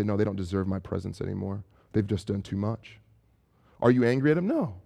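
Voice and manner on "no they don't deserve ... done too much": low, soft and bitter; cold resentful muttering. Retold or imitated. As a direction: shut them out quietly. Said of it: "you say".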